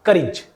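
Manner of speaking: करेज said as KUH-rij; short i ending. Shown as a demonstration. Teacher applying the rule, करेज as kuh-rij. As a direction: end करेज in a short rij, not rayj. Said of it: "ई की मात्रा लग जाएगी".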